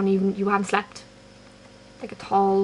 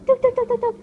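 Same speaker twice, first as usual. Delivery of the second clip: high squeal of delight; excited squeal; 5 dB louder than usual. Real, not acted. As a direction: shriek it at a cute dog. Excited at seeing a dog.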